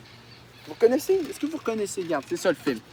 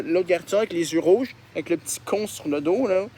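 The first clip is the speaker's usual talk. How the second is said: ordinary talk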